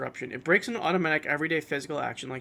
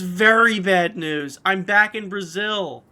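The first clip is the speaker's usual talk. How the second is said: mock dramatic; 4 semitones higher, 9 dB louder, slower than usual. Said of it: "Geeko says".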